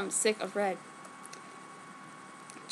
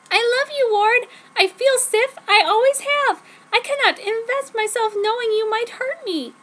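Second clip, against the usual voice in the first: high, louder, overwrought pleading; the lovesick heroine begging to be trusted; breathless melodramatic girlfriend voice; dramatic teen heroine; 10 semitones higher, 4 dB louder than usual. Acted. Heroine confesses to Ward.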